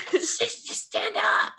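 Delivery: high, strained, drawn-out plea; panicked pleading. Acted. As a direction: beg slowly in a high, panicky squeal. Terrified Timmy urging fallen Paul.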